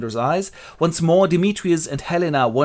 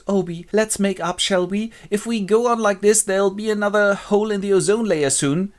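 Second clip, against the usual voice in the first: higher-pitched peacemaking wife voice; coaxing fairy queen; 8 semitones higher than usual. Acted. Fairy queen making up with Oberon, coaxing. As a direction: coax him in a raised voice. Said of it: "But she goes".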